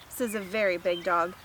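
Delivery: usual speaking voice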